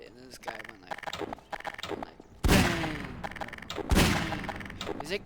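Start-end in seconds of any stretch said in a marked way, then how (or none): 2.4-5.0 loud, drawn-out bangs; big mock gun noises; imitated gunfire. This guy shooting, imitated gunfire.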